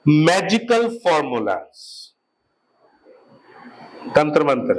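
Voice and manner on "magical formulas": very slow, every word drawn out; teacher dictating a definition, slow; slow dictation. Shown as a demonstration. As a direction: dictate it slowly for notes. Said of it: "dictating definition".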